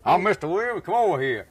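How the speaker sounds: high, loud showing-off host; bragging host pointing out sights; gushing Texan host. Retold or imitated. Fort Worth host showing off the town, high gushing.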